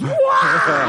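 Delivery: high, explosive "waah" outburst; imitated crowd roar. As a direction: burst out like a whole room erupting. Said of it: "the whole place just goes".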